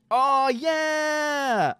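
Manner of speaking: a high, thrilled yell; excited shout. Real, not acted. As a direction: cheer it out as the toy appears. Excited reveal of a new toy.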